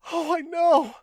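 high, loud, tearful cry of a woman; woman insisting to the scientist, sobbing loudly. Acted. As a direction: wail it out through the tears. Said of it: "the woman sobbed".

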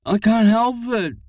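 high, strained sobbing voice. Acted. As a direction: sob the words out helplessly. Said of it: "sobbed Charlie".

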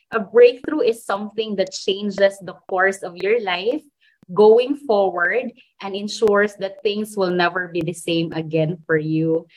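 usual speaking voice

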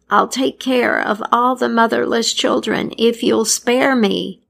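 lightly voiced old woman; old woman pleading. A narrator's reading. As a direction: plead with the plague spirit. Crone bargains with plague figure.

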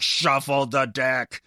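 furious emphasis. Retold or imitated. Telling listeners how he shuffled the deck, loud and forceful. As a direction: lean into it like shuffling aggressively. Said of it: "like, aggressively".

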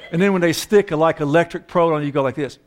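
ordinary talk

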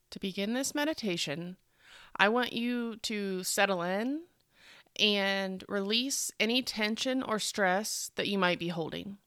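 slow, soothing meditation voice with long pauses; guided meditation. Acted. Host helps listener settle in and breathe, slow guided meditation. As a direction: ease them gently into relaxation.

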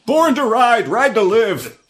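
high loud hammed-up chant; biker motto shout. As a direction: belt it like a biker slogan.

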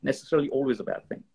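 ordinary talk, nothing marked

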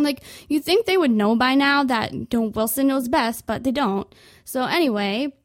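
ordinary talk, nothing marked

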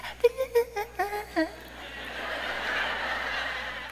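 long high laugh at her own joke; big laugh. Real, not acted. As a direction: burst out laughing.

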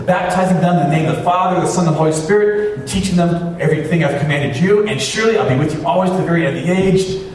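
high-pitched, rolling sermon delivery; preacher reciting scripture. Real, not acted. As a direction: recite it in one driving run.

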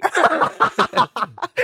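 squeaky burst of laughter; high giggle. Real, not acted.

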